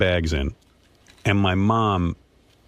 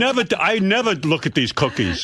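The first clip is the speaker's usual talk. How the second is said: father's voice; 9 semitones higher than usual. Retold or imitated.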